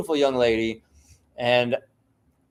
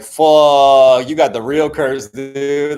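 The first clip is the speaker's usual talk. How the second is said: a loud, shocked curse; shocked outburst; 2 semitones higher, 9 dB louder, much slower than usual. Retold or imitated. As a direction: blurt it out loud, stunned. Reacting to friend's death.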